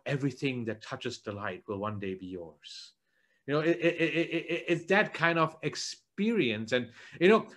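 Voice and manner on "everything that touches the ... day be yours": a hint of Mufasa, low and soft; a lightly voiced, hushed Mufasa line. Preacher quoting Mufasa to Simba, low and soft. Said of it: "when Mufasa tells Simba".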